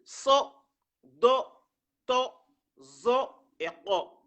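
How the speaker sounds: "so, do, to, zo et ko", each slow on a rounded O; five emphatic O sounds. Shown as a demonstration. Teacher listing the five exception letters, slow rounded "so, do, to, zo et ko". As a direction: list "so, do, to, zo et ko" slowly with full O vowels. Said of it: "le fatha devient le O".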